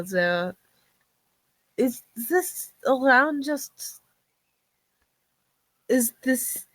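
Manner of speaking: halting, hesitant newcomer with long pauses; struggling for words. Acted. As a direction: grope for each phrase, stopping short. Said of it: "she's struggling to find the words".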